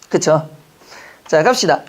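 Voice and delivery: usual speaking voice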